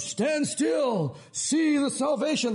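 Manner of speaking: hammed-up heroic call, stretching the words; proclaiming Moses. Moses proclaiming to the people, hammed-up grandeur.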